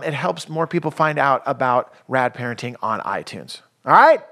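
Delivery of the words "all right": high, loud radio announcer. Shown as a demonstration. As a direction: put on your big radio-host voice. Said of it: "that was my radio, uh, voice".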